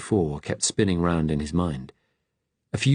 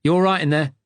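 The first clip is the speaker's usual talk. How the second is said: guard calls through the door, loud and higher; loud, raised call through a door; 9 semitones higher, 5 dB louder, faster than usual. A narrator's reading. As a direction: shout through the door to check on him. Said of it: "the guard's voice, loud".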